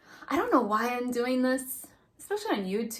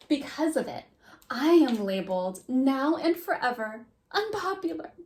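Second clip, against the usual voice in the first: lively dramatic diary reading; reading old diary. Acted. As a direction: read it like a proud preteen. Reads preteen diary aloud.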